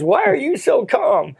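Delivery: high-pitched imitation of an alarmed passenger; mock panicked question. Frightened seatmate to calm believer, mock panicked question. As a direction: ask it high, in pretend alarm.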